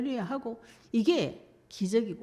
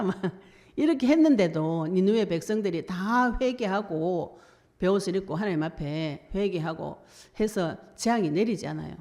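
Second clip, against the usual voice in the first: normal talking voice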